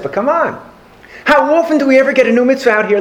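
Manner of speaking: high, quick, eager pleading; excited coaxing. Acted. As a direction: coax the teacher like an excited farmer. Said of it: "The farmer got all excited".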